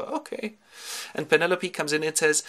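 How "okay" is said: tiny high, soft voice; meek okay. Acted. Nurse told to shut up, meek soft okay. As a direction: squeak a cowed reply.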